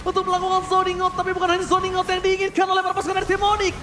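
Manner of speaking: loud, fast play-by-play hype; esports caster. Acted. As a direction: call the teamfight at full energy. Hyped play-by-play of teamfight.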